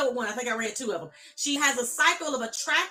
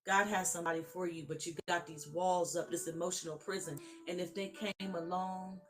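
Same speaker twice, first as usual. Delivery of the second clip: tender preaching; 5 semitones lower, 8 dB quieter than usual. Acted. Preacher comforting hurting women, soft and tender. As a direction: speak gently to the broken.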